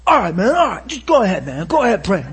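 loud, exasperated retold consent; grudging giving-in. Man giving in to his friends' nagging, loud grudging consent. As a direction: give in loudly, as if badgered into it. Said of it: "So here's what he does".

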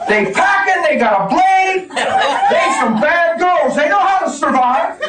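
high, loud preacher's cry with laughs; full-force preaching. Acted. Pastor telling the church about the girl, preaching at full force. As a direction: preach it out to the rafters.

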